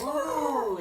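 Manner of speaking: plain reading voice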